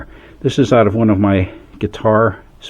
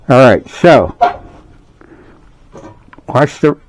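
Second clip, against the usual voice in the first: talking around a phone in the mouth; phone held in teeth; 2 semitones higher than usual. Real, not acted. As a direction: speak with a phone clenched in the teeth. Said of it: "I'm holding my phone with my mouth".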